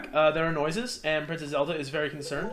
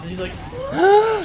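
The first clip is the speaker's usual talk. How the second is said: 1 imitated yell; 9 dB louder, much slower than usual. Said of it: "freaky sounding yelling noise".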